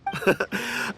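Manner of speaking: breathy amused chuckle; a short airy laugh, pleased with himself. Acted. Merchant amused as he sizes up a rival, airy chuckle.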